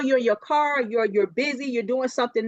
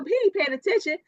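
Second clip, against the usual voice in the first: ordinary talk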